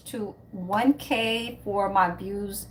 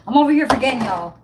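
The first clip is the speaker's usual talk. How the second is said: louder exclaiming at her own forgetfulness; loud, exasperated at herself; loud self-scolding; 3 semitones higher, 8 dB louder, much faster than usual. Real, not acted. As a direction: call out your own blunder. Laughs at herself.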